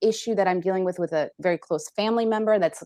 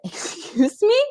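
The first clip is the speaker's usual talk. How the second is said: high-pitched, affronted retort; mock indignation; 10 semitones higher, 3 dB louder, slower than usual. Coach pulling up a self-critical client, mock indignant. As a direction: act scandalised by what you just heard.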